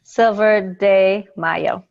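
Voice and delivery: "de" said clearly as "day", slowly; slow demo, "de" as Spanish "day"; slow correct Spanish. Shown as a demonstration. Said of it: "D E is day in Spanish".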